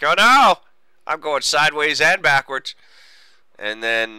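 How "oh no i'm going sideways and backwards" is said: panicked. Retold or imitated. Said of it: "Dave's like".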